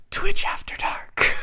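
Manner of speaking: slow whispered tagline; mock late-night whisper. Joking after a remark too hot for Twitch, playful whisper. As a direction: whisper it like a naughty show title.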